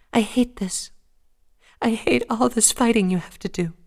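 high, distressed, near tears; hating all the fighting; upset shy pony. Acted. Fluttershy upset.

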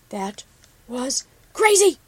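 loud, slow scolding exclamation; admonishing. Acted. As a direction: scold with relief and alarm. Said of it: "Copper admonished".

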